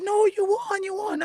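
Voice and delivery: very high squeal, imitating the crying friend; excited screaming. Friend calling from the awards, shrieking with joy. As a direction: mimic a friend shrieking the news. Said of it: "she starts screaming and screaming and she's crying".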